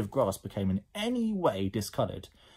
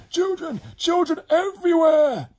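very high, loud panicked shrieks; horrified scream; 10 dB louder than usual. Acted. Edmund surrounded by slime children, horrified scream. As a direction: scream it, building to the last word. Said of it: "screamed Edmund".

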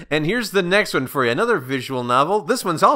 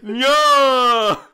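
mock despair; 9 semitones higher, 5 dB louder than usual. Skinner dismayed.

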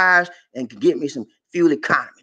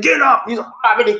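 mock shout; 5 semitones higher, faster than usual. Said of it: "I said".

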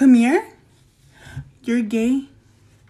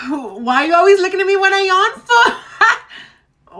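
whiny complaint; 6 semitones higher, 4 dB louder than usual. Acted. Whining at teacher about yawning.

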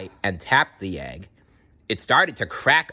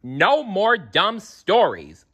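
slow, raised-pitch scolding; stern; 9 semitones higher, 4 dB louder, slower than usual. A narrator's reading. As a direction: put a firm stop to it, word by word. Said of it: "Littlefoot said sternly".

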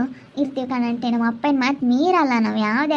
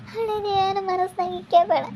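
high, crying wail of a plea; the words breaking into a higher sob; 7 semitones higher, 3 dB quieter than usual. Acted. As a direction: daughter wails her plea to her father, crying. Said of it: "ಸುಪ್ಪು, ಅಳ್ಬೇಡ".

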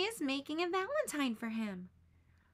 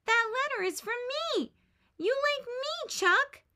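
much higher and louder, insisting hard; insistent tomboy; 12 semitones higher, 6 dB louder than usual. A narrator's reading. Tomboy tells boy the valentine is from her, insistent. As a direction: push the claim at him, high and loud. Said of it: "she insists".

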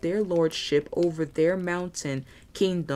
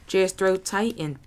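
ordinary delivery

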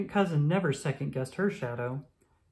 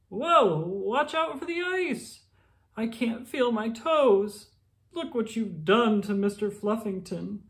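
alarmed townsfolk; 7 semitones higher than usual. Acted. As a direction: yelp as they slip on ice. Townspeople slip and complain.

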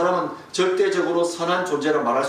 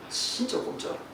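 a breathy aside as the wire won't budge; hushed whisper; 7 semitones higher, 8 dB quieter than usual. Retold or imitated. Preacher telling the congregation about the stuck wire, whispering. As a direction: whisper it in dismay, barely voiced.

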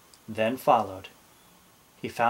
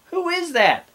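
very high, louder little pirate; alarmed Dots; 5 dB louder than usual. A narrator's reading. Dots to captain after fleeing, high alarmed question. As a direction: squeak out the question, far above normal. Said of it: "asked Dots".

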